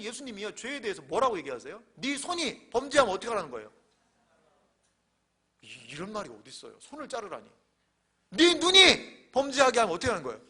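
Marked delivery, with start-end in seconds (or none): 1.9-3.7 loud, raised preaching challenge; stern command. Retold or imitated. Preacher quoting Jesus to demand firmness against sin, forceful. 8.3-10.4 loud, high burst of preaching; stern shouted command. Retold or imitated. Preacher quoting Jesus on the sinning eye, shouted demand.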